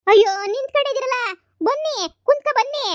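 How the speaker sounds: high, loud over-sweet welcome to the in-laws; fawning hostess. Acted. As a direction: fuss over the guests in a high put-on voice.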